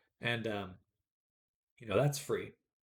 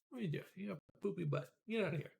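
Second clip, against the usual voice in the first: cat talk; 7 semitones higher, 6 dB quieter, faster than usual. Real, not acted. Streamer teasing her cat about its butt, soft baby talk. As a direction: tease the cat fondly.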